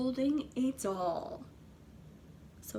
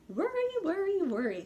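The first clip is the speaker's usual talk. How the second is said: high, fussing granny; tut-tut like an old granny; grandmother's voice; 6 semitones higher than usual. A narrator's reading. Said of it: "said her grandmother".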